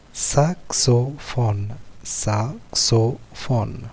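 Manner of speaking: slow "saxophone", split into sa, xo, phone; syllable by syllable. Shown as a demonstration. Teacher giving pupils a three-syllable word, syllable by syllable. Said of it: "trois syllabes".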